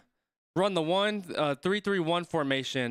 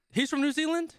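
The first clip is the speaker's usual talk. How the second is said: surprised; 10 semitones higher than usual. Real, not acted. Watching rugby, surprised the Irish player is from New Zealand, high disbelieving question. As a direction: pitch jumps way up in disbelief.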